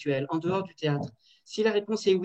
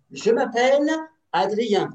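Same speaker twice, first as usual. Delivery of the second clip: high, loud, showy Je m'appelle Adrien; exaggerated high introduction for the class; big lively demo; 6 semitones higher, 6 dB louder, slower than usual. Shown as a demonstration. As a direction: announce your name loudly like a game host. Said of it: "la même intonation".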